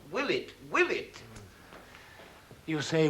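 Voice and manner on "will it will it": "Will it?" lifted high as a question; questioning tune. Shown as a demonstration. Director correcting the actor's line, "Will it?" said as a high question. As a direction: make "Will it" a real question, pitched up. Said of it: "It's a question".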